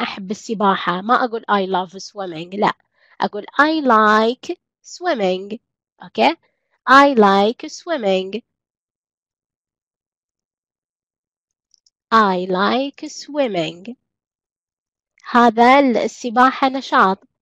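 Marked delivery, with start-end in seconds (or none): 3.6-5.6 careful, slowed teacher delivery; lesson-pace example; slow model sentence. Shown as a demonstration. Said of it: "teacher models English sentence". 6.9-13.9 drawn-out classroom modelling; slow repeat for learners; very slow model sentence. Shown as a demonstration. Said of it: "teacher models English sentence".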